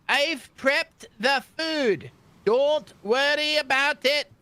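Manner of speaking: high, slow, loud little gnome voice; food-covered gnome cook. Acted. Gnome cook reassuring new passengers, high and slow. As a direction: squeak it out slowly as the flustered gnome. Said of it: "And she's like".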